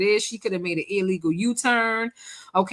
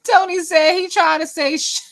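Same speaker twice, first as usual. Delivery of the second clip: cracking up mid-sentence; laughing; 7 semitones higher, 7 dB louder than usual. Real, not acted. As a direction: laugh through the words. Laughing at mispronunciation.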